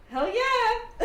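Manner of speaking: loud, high laughing whoop; elated cheer. Acted. Old squadmate recognises his general, loud elated whoop. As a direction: whoop it like a thrilled old war buddy.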